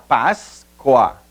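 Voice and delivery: "Páscoa" split as Pás, coa; "Páscoa" drawn out in two detached syllables. Shown as a demonstration. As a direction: separate the two syllables of "Páscoa" with a clear gap. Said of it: "separação silábica".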